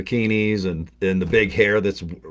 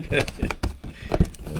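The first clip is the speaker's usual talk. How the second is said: laugh; 6 semitones lower, 7 dB quieter than usual. Real, not acted. Opening the gift box.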